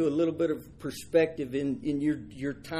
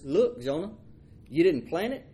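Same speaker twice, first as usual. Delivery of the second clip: higher, firm listing of what Jonah didn't do; God scolding; 7 semitones higher than usual. Acted. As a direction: tick off each point like a patient father. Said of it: "And God says".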